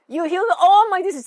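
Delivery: loud, high proclamation of the healing verse; shouted declaration. Retold or imitated. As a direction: shout it out with faith. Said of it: "She shouted it".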